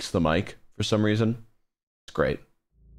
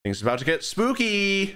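much higher, sing-song mock spooky announcer; playful high spooky voice; 10 semitones higher than usual. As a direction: tease the creepiness in a high put-on voice. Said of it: "don't know why I talk like that".

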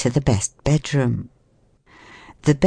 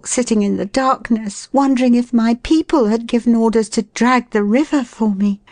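high, pleading woman's voice; plaintive, apologetic lady; 9 semitones higher than usual. Acted. Woman explaining her night in the dark, plaintive. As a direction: pour out excuses in a high voice.

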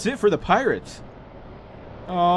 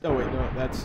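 ordinary talk, nothing marked

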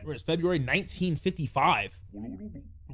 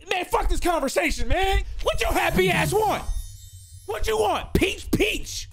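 fed-up outburst; 12 semitones higher than usual. Real, not acted. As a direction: lose patience and yell it out high. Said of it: "Now I'm just mad".

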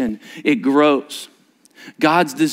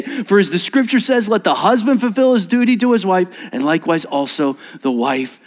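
raised formal reading; liturgy reading; 7 semitones higher than usual. Shown as a demonstration. Pastor reading the wedding vows to the couple. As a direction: read it out with ceremony.

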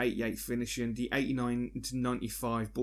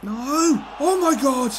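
much higher and louder, full-voiced cry; real scream; 13 semitones higher, 10 dB louder, slower than usual. Real, not acted. Gamer screaming at a chance on goal, loud. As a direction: scream at the near miss.

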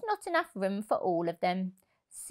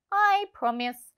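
fox promise; 10 semitones higher than usual. A narrator's reading. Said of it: "said the fox".

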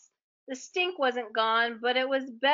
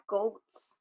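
a softer, small monster voice; tiny monster; 3 semitones lower, 5 dB quieter than usual. Acted. The tiny monster smelling the goats, a softer small voice. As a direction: murmur it like a tiny hungry creature. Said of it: "said the tiny monster".

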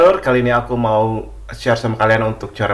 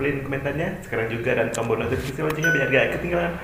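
normal talking voice

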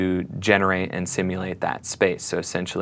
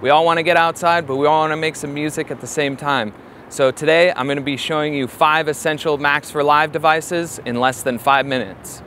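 bright upbeat presenter opener, pitched up; energetic host intro; 8 semitones higher than usual. Acted. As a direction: hype up the viewers like a YouTube host. High-energy intro to camera.

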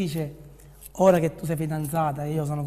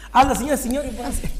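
higher, louder presenter opening; showy game show host; 5 semitones higher, 3 dB louder, much faster than usual. Pretend. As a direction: announce it like a TV host. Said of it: "Devo fare il presentatore io".

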